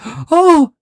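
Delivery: a startled cry of shock; imitating the astronaut's shocked gasp. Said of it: "you can actually hear her say".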